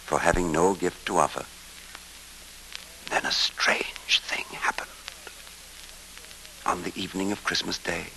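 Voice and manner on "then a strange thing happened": hushed storyteller whisper. A narrator's reading. Storyteller whispers to listeners before the strange event. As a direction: lean in and breathe it, building suspense.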